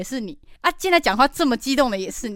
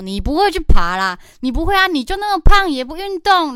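taunting; 6 semitones higher, 8 dB louder than usual. Retold or imitated. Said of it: "他朋友就一直激将法说".

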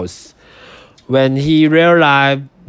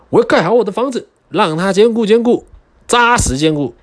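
determined pig voice; 9 semitones higher, much faster than usual. Acted.

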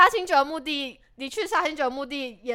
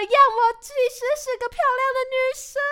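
mock pretty girl voice; 11 semitones higher, 6 dB louder than usual. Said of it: "你是不是现在很想把假发拿掉，说".

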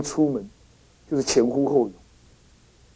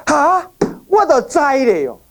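alarmed mother; 7 semitones higher, slower than usual. Retold or imitated.

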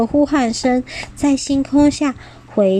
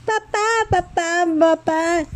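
激动呼喊; 7 semitones higher than usual. Acted. 宝宝们喊爸爸.

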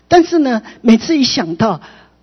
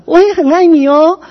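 higher, louder, sing-out affection; affectionate declaration; 8 semitones higher, 7 dB louder than usual. Retold or imitated. Child answers a scolding father with love, lifted affectionate declaration. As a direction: tell Dad you love him, voice lifted.

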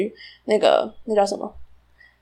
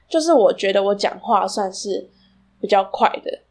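ordinary delivery

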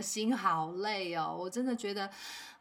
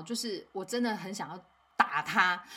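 ordinary delivery

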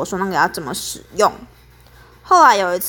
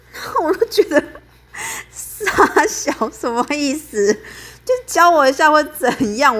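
whiny inner protest; 7 semitones higher than usual. Retold or imitated.

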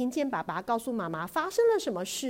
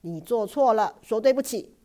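stern; 3 semitones higher, 8 dB louder than usual. Acted. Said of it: "爸爸瞪大眼睛地说".

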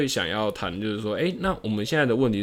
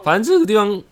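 voice cracking; 11 semitones higher, 8 dB louder than usual. Real, not acted. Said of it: "我刚才破音是不是？".

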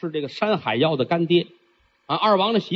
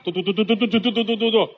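quicker "嘟嘟" patter, slightly higher; a quick "嘟嘟" footstep patter for the fast-walking prince; 4 semitones higher, faster than usual. Acted. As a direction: patter "嘟嘟" like a hurried walk. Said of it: "走道快".

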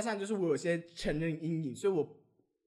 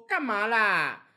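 annoyed retort; 3 semitones higher, 7 dB louder, much slower than usual. Retold or imitated. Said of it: "我就很生气".

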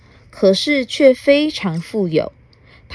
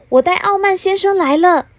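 much higher and louder cheerful reply; fairy voice; 11 semitones higher, 4 dB louder than usual. Acted. Fairy answers the castle guard, high and bright. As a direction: proudly announce the guest. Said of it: "小精灵立刻回答".

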